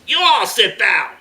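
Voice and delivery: high, loud, slow shout; full-force terrorist bark; yelled command. A narrator's reading. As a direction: yell an order at captives. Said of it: "Another terrorist yelled at the kids".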